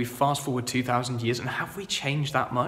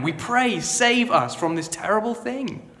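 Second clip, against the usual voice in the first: mock hosanna plea; 8 semitones higher, 3 dB louder than usual. Voicing modern hosanna cry.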